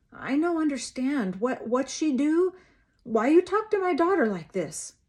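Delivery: put-on lightly accented, rising pleading voice; mother pleads in broken English; Mexican mother's accent. A narrator's reading. Mother protests sheriff's harsh words, Mexican accent.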